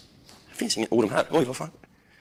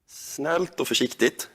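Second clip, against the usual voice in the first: slow and gentle; 6 semitones lower, slower than usual. Real, not acted. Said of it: "jag kommer nu att sakta".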